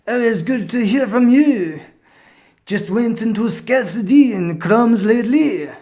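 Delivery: high, hammed-up French accent; butchered French accent. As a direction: put on a badly faked French accent, loud and lilting. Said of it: "this is probably my butchered French accent".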